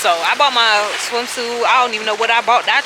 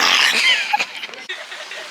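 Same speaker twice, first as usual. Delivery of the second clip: squealing laugh, much higher; high burst of laughter. Real, not acted. Vlogger at cliff edge refusing to jump, high squealing laugh.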